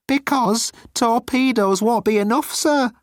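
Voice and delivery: high, timid alien voice; high-pitched nervous alien; Moan's voice. Acted. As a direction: play Moan small and pleading.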